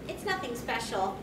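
ordinary talk

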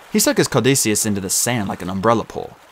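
ordinary delivery, nothing marked

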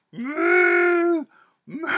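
high funky mooing cow. Shown as a demonstration. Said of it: "Make a cow noise".

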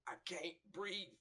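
a light imitation of a man gasping that he can't breathe; lightly voiced victim pleading on camera; choking man. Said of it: "when the man say".

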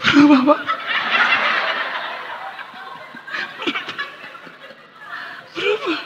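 small, strained mock-pained reply; acted holding back pain. Wife whose foot is being stepped on, mock-pained. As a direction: force it out as if hiding pain. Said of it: "Bilang berubah sambil meringis, nahan sakit".

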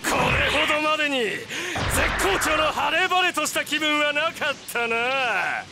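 high, exultant villain savoring his power; triumphant vampire lord, giddy with power; gloating villain. Acted.

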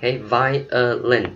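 "violin" slowly split as vai-uh-lin; syllable by syllable. Shown as a demonstration. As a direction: break "violin" into three slow syllables. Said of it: "Chữ này đọc là violin".

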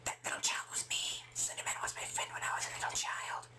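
hushed, eerie whispered confession; creepy whisper. Pretend. Claiming to be Slenderman's little friend, creepy whisper. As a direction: whisper it like a spooky secret.

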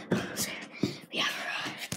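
voiceless hush; whisper. Real, not acted. Reaching the bathroom unseen, whisper. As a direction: breathe it very softly.

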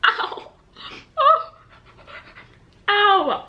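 sharp high ow of pain; pained yelps. Real, not acted. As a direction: yelp from a scalding bite. Burns tongue on hot food.

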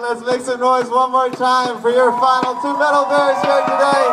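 loud, drawn-out crowd call; announcer hype. Real, not acted. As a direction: pump up the crowd. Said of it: "hypes crowd to cheer".

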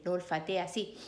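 ordinary delivery, nothing marked

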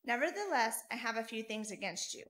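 normal talking voice

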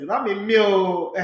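ordinary talk, nothing marked

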